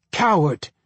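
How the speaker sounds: understated scream of accusation; a touch of a scream, narrated; mild mock scream. A narrator's reading. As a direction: call it out as a restrained scream. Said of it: "she screamed at a nearby doctor".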